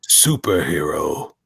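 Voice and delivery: slow, low tagline voice; Boyette impression. Host reading the tagline as Boyette would, slow low impression. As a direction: stretch the word out low. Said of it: "my best impression of the way".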